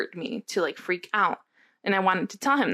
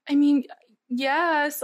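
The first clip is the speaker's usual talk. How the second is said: higher, hesitant retold yes; reenacted vulnerability; 6 semitones higher, much slower than usual. Woman asked if she trusts him, reenacted vulnerable voice. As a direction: reenact yourself giving in, voice lifted. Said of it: "your voice is sorry".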